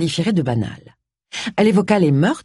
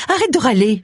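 higher, impatient retort; irritated; 6 semitones higher than usual. Acted. Agatha telling James to stop, irritably. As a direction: cut off the grumbling.

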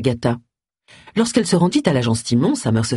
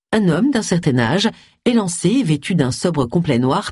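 ordinary delivery

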